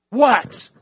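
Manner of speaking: high, loud snapped what; annoyed husband. Acted. Husband answering the rude parrot, high loud what. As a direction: bark it back at the bird.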